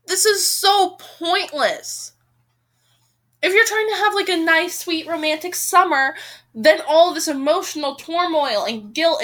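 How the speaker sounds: high, loud scolding of a character; exasperated rant. Real, not acted. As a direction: lecture her, fed up and heated. Reader scolds the book's heroine.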